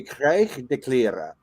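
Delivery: "krijg de kleren" with a guttural g; "krijg de kleren" said the Dutch way, throaty g; Dutch pronunciation. Shown as a demonstration. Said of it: "That is a pretty good pronunciation".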